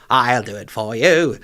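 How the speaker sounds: slow, camp offer. Retold or imitated. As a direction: step in with a breezy camp offer. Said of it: "And he's like".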